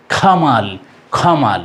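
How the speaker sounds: "খামাল" with aspirated k; breathy kh opening "খামাল", falling tune; corrected pronunciation. Shown as a demonstration. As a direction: puff air after the k of "খামাল". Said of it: "এখন বলবো".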